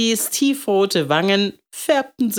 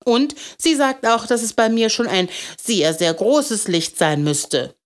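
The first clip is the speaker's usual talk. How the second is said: put-on higher child's voice; troubled little boy; 6 semitones higher than usual. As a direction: report the teacher's verdict like an upset kid.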